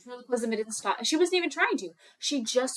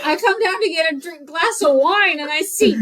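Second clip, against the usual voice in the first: loud, higher mock rant with laughs creeping in; exasperated mom; 7 semitones higher, 12 dB louder than usual. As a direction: rant like a fed-up mother, hammed-up. Acted exasperated mom's rant.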